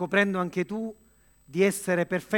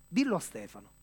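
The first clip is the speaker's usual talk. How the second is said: normal talking voice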